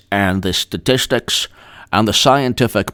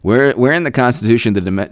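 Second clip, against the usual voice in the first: usual speaking voice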